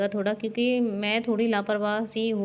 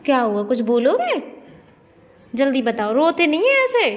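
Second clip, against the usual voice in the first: very loud, rising to high pitch; loud insistent questioning; 4 semitones higher, 8 dB louder than usual. Real, not acted. Asking crying papa what happened, loud and insistent. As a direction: urge an answer at full voice.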